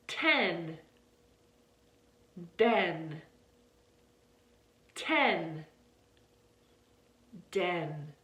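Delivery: Ten with a breathy t, Den with a voiced d; t and d contrast. Shown as a demonstration. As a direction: model Ten and Den slowly for repeating. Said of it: "So repeat after me".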